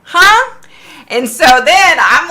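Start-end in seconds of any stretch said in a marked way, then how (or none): 0.0-0.6 high, loud puzzled yelp; baffled reaction. Retold or imitated. Viewer reacts to the psychic's random answer, baffled. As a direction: let it jump right up in disbelief.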